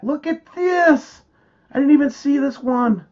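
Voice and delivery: high-pitched astonished exclamation; excited amazement. Real, not acted. Host spotting something new on screen, real excited amazement. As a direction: burst out at a surprise find. Spots surprise find on screen.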